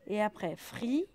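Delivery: ordinary talk, nothing marked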